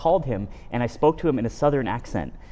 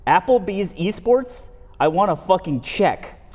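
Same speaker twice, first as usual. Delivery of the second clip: high, insistent begging rant; hammed-up desperate demand, pitched up; desperate plea; 4 semitones higher, slower than usual. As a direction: plead for it, drawing out the key words. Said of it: "You are desperate".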